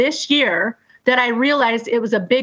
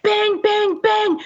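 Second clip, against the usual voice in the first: mock bangs; 10 semitones higher, much slower than usual. Imitating banging on door.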